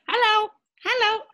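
very high, squawky hello hello; parrot voice. Shown as a demonstration. Teacher showing the child a parrot, very high and squawky. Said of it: "That's kind of what a parrot sounds like".

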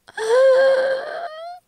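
high, loud squealing laughter; shrieking laugh. Real, not acted. Shrieking laugh after line. (甲高く叫ぶような笑い)

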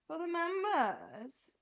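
high, drawn-out singsong echo; playful echo. Real, not acted. Playful echo of own words.

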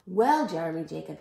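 low, loud pirate voice; hammed-up storybook sea dog; pirate captain. A narrator's reading. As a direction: address the boy as a pirate chief. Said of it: "he said".

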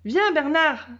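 high, eager call; little girl calling. A narrator's reading. Little girl urging her dog out into the snow. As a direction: call the dog to come play.

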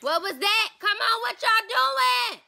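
loud, high yell at the players; exasperated shouting. Real, not acted. Fan to players after a bad play, exasperated shout. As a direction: holler at the team, drawing out the complaint. Fan yelling at team on screen.

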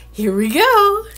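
high, loud and bright kickoff; excited. Real, not acted. Reader kicking off the book for listeners, excited. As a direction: launch the reading with a burst of excitement.

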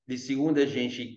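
normal talking voice

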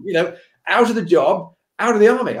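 high-pitched, hammed-up doom; retold panic. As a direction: spiral into comic despair, voice high. Retelling panic over career ending.

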